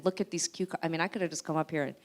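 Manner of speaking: ordinary talk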